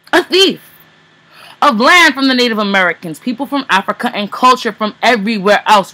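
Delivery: forceful slam poetry, punched key words; spoken word poem. Acted. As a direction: declaim it loud and accusing, like a poet on stage.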